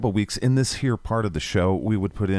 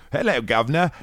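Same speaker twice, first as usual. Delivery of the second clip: hammed-up, higher English greeting; bad English accent; 6 semitones higher than usual. Shown as a demonstration. Host showing how badly they do English accents, bad English accent. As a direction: try and fail at an English accent. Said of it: "I suck at English accents".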